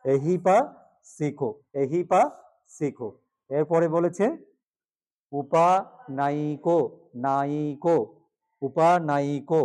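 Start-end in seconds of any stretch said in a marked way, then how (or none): 0.1-3.1 এহি পাসিকো slow with a clear h; slow correct model. Shown as a demonstration. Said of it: "কি বলবে?". 5.3-9.6 উপানায়িকো slowly with a ya glide; slow careful pronunciation. Shown as a demonstration. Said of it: "অন্তস্থ জ উচ্চারণে".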